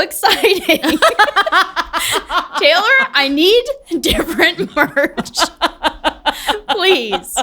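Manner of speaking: loud laughing plea. Real, not acted. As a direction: laugh through the complaint. Laughing over disappointing merch.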